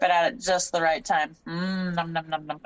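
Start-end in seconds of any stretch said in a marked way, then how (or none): 1.5-2.6 low slurred numb mumble, laughing; numb mouth mumble. Retold or imitated. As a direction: mumble through a numbed mouth. Repeats numb mumble joke.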